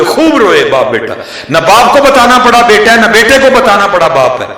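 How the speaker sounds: high, loud fervent preaching; preaching at full force. Acted. Preacher thundering the reunion of father and son, fervent. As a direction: drive the climax home to the crowd.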